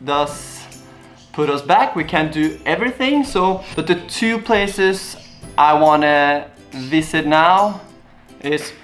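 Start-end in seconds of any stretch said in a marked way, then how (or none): none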